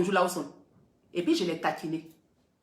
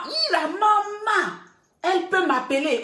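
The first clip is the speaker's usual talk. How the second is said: high, stretched, very slow "hi, la maman"; squealing mock joy at mum's call; mock delighted surprise; 7 semitones higher than usual. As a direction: draw out "la maman" as if amazed she called. Mimics the blogger's surprise at mum.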